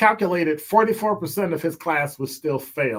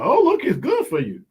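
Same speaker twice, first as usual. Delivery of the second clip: mock naive approval; 10 semitones higher than usual. Mocking someone eating anything.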